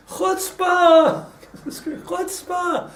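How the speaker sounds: high, loud outraged scream; imitating a furious shout, laughter breaking in; Carlebach screaming. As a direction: hurl the word like an outraged speaker at a crowd. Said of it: "he's screaming at the council, screaming, literally".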